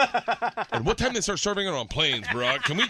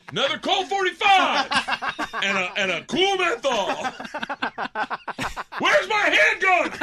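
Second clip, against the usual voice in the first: loud, high comic holler; over-the-top mock shouting; hammed-up caricature; 9 semitones higher, 5 dB louder than usual. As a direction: bellow the demands, stretching the last one out. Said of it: "Let's rephrase that story in what it would have said".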